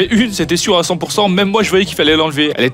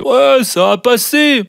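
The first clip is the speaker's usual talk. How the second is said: dismissive drawl; 6 semitones higher, much slower than usual. Retold or imitated. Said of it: "ils se disaient".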